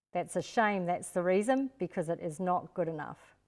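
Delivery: ordinary talk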